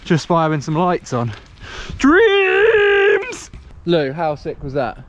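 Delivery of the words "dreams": high, loud drawn-out cheer; excited yell. Real, not acted. Calling out after run.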